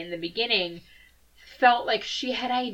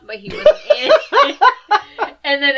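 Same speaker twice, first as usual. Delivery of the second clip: laughing; 4 semitones higher, 11 dB louder, faster than usual. Real, not acted. Breaks into laughter.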